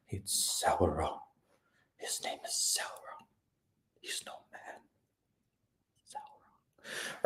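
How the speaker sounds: spooky mock-reveal whisper; dramatic whisper. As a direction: breathe the name like a dark secret. Dramatic reveal of Sauron's name.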